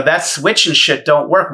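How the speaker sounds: usual speaking voice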